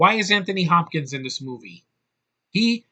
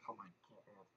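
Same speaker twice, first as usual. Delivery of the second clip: breathless whisper of disbelief; an unvoiced "oh my God"; whispered exasperation; 6 semitones lower, 32 dB quieter than usual. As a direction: whisper it in despair. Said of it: "And I'm like".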